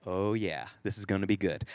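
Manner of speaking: low, soft aside; hushed, low anticipation; quietly thrilled dad. Retold or imitated. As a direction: savor it under your breath. Dad privately relishing the mischief.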